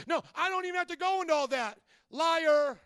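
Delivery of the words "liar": louder, a flat verdict; accusing "Liar"; loud accusation. Real, not acted. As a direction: call it out like a judge. Pastor calls out liars.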